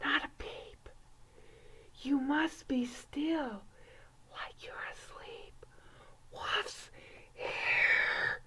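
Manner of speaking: breathy hushed whisper. Acted. Hiding from the wolf with the kids, whispering. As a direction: whisper so the wolf won't hear. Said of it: "Hush now, hush now".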